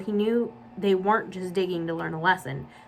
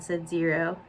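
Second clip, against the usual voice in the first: ordinary delivery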